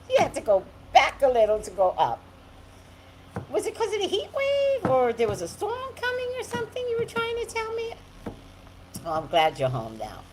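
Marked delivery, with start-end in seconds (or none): 3.5-7.9 higher playful teasing question. Pretend. Asking Nikki teasingly, higher. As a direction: tease her with a high sing-song ask. Asks viewer in high voice.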